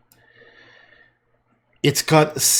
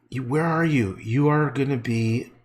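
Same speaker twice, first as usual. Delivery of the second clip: ordinary talk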